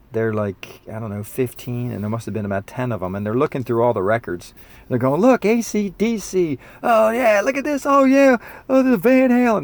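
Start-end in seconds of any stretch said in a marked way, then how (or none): 5.2-9.6 put-on much higher and louder, gushing like a teenager; excited teen kids. Teen kids in record store, excitedly shouting finds. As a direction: squeal over the records like a thrilled kid.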